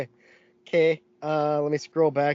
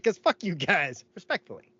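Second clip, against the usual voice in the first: deadpan jab, then politely tacked on; mock polite insult; 3 semitones higher, 5 dB quieter than usual. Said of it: "respectfully that got him".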